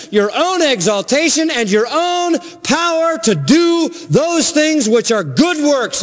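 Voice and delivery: high-pitched, insistent preacher's delivery; impassioned preaching. Acted. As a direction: drive each phrase home from the pulpit, pitch held high. Preaches at full force.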